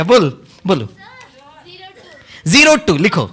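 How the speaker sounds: ordinary talk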